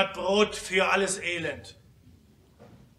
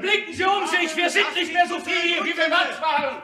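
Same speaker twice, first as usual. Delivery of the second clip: higher, fast urgent call; urgent shout; 11 semitones higher, 5 dB louder, faster than usual. Acted. Delegate urging the assembly to look around, shouting. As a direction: plead loudly with the hall. Desperate appeal in debate.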